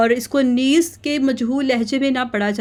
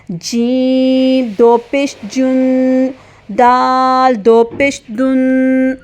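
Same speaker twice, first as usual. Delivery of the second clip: slow chanted letter-by-letter recitation; slow spelling drill; much slower than usual. Shown as a demonstration. Teacher to kids, slow chanted spelling drill. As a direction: spell each letter out slowly for children. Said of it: "اس کی sound کیا ہے؟".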